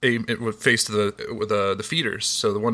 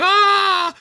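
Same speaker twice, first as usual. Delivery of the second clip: retold high crying wail; 4 dB louder than usual. Boy sees the squirrel he shot, wails. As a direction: cry out like a scared kid. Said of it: "I'm like".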